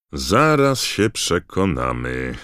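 slow, loud growl of a sceptical official; growled threat. A narrator's reading. Diggory growls at the doubting kids, slow and gruff. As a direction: bite off the words, unconvinced. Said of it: "warknął pan Diggory".

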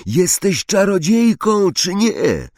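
very high, drawn-out exasperated cry; stretched high howl. A narrator's reading. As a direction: wail the question slowly in disbelief. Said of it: "zawył Ron".

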